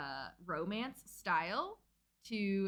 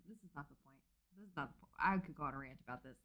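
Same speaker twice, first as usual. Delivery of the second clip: quiet; 6 semitones lower, 8 dB quieter, faster than usual. Real, not acted. Cutting herself off from rant.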